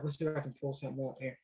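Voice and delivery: hushed talking to yourself while searching; self-talk aside. Real, not acted. Talks to himself while searching charts.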